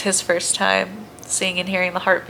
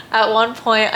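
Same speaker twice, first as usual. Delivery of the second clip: normal talking voice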